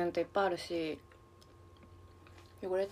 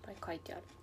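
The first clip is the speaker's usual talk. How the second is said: whispering; 3 semitones lower, 13 dB quieter, faster than usual. Real, not acted. Whispered aside.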